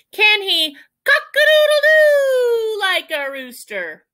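high, loud question with full rooster crow; crowing hen challenge. Acted. Hen testing the owl's crow, high loud crowing. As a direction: belt out the crow inside the question.

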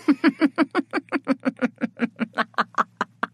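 a slow, menacing chuckle that fades out. Acted. Villain laughs after taunt.

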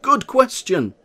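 high, loud excited exclamation; crowd exclaiming. A narrator's reading. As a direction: villagers shouting in eager agreement. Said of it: "And they said".

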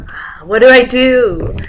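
high, loud acted helplessness; mock dismay. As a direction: wail it as if at a loss. Acts out being left alone.

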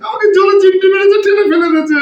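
high, loud crying complaint; loud childish sobbing. Acted. Son bawls to mother that his wife pinched him, loud childish sobbing. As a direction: bawl like a hurt little boy.

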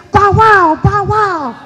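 high, loud "bow wow" dog; dog barking. Acted. Hoosie the dog running in front, barking bow wow. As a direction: bark the words out like an eager dog. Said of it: "He's saying".

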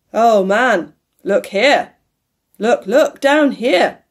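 commanding ghostly cry; loud exclamation. A narrator's reading. The ghost orders Scrooge to look at the children, exclaiming. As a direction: exclaim it forcefully, building to the end. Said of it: "exclaimed the ghost".

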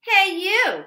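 loud high call; loud grouchy hey you; grouchy challenge. Acted. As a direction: pick a fight.

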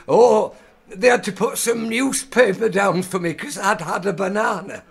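put-on deaf old northerner, high and loud; high, shouted old-codger voice; Alfie. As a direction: barrel on at full volume as a confused old man. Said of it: "my character is hard of hearing".

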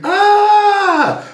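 high-pitched drawn-out mock scream; loud, sustained "aah" shriek in imitation; imitated scream. Husband imitating his wife screaming in the water, mock scream. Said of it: "and she screamed".

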